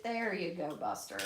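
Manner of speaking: ordinary delivery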